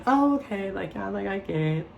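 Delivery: high, fake-bright brush-off; fake polite okay. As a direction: cover shock with a sweet okay. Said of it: "I'm like".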